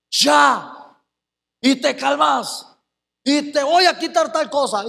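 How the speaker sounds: firm, hoarse fatherly command; hoarse, weighty order to a misbehaving child; stern parent voice. Retold or imitated. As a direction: put weight in the voice like a strict dad. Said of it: "cuando los hombres sacamos la voz ronca".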